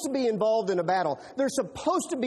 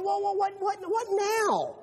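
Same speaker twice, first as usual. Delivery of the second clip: much higher, slow startled whoa; mock bewildered little brother; startled David; 10 semitones higher, slower than usual. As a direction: act caught off guard. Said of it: "And David's going".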